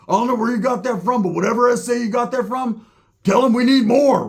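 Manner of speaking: imitated excited demand. Roach excitedly demands more drugs.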